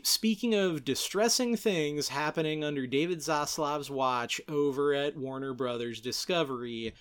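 usual speaking voice

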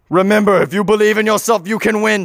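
rousing mock-heroic encouragement; brain's pep talk. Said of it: "And Brain goes".

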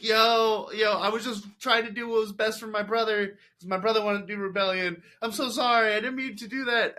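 high, loud, overwrought apology; mock-frantic pleading young man. Apologetic guy voiced in recap.

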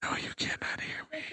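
faint unvoiced whisper. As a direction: whisper below the mic. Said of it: "If you talk too low".

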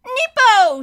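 put-on high, loud toddler shriek; ringing baby voice. A narrator's reading. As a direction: pipe up piercingly like a small child. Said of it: "Sunny's unmistakable voice rang out".

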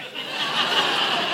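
a real, breathy burst of laughter; real laughter without a word. Real, not acted. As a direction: laugh out at the surprise. Laughing at the punchline.

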